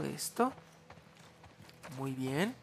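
usual speaking voice

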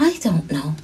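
soft, downcast murmur; sad. A narrator's reading. Lost girl answering the wolf, softly sad. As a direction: say it softly, a touch defeated. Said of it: "she said sadly".

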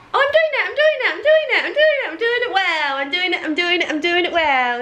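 high, singsong excited chant; gleeful boasting. Real, not acted. Woman managing the head-and-belly trick, excitedly celebrating.

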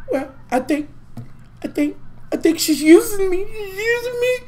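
whiny voice rising into a fake sob; mock crying. Mocking castmate's tearful complaint.

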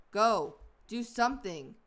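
usual speaking voice

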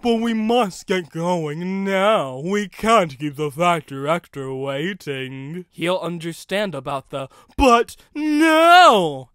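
loud, slow, heavy engine voice; a surly engine refusing to wait; annoyed Fulcrum. A narrator's reading. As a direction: grind out each word impatiently. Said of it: "Fulcrum was annoyed".

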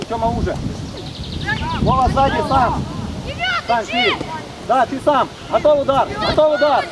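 ordinary talk

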